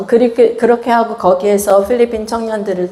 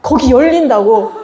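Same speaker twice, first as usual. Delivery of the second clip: ordinary talk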